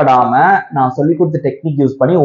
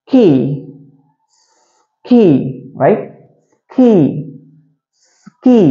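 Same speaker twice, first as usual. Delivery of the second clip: s joined more quickly to 'கீ'; a little faster; much slower than usual. Shown as a demonstration. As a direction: shorten the gap between hissed s and 'கீ'. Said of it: "கொஞ்சம் வேகமா சொல்லுங்க இப்போ". ('ஸ்' உடன் 'கீ' சற்று வேகமாக)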